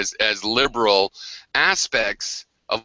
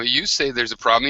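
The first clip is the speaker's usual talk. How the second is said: ordinary talk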